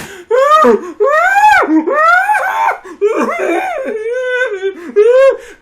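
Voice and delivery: loud, high exaggerated wailing; mock bawling. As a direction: imitate a girl bawling her eyes out. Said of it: "crying, bawling her eyes out".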